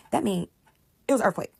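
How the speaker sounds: usual speaking voice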